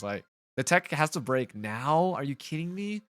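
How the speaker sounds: usual speaking voice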